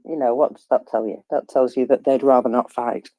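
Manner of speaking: normal talking voice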